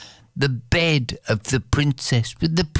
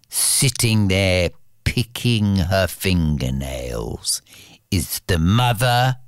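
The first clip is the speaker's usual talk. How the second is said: usual speaking voice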